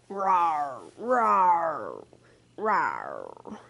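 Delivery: a gentle pretend jaguar roar; playful roar. Mother jaguar roars.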